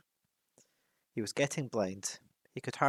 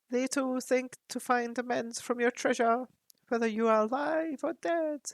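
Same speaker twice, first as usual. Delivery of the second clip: higher, grave raven voice; counselling raven; 13 semitones higher, 3 dB louder than usual. Acted. Raven Roak counsels Thorin about coming danger. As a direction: warn him like an ancient advisor.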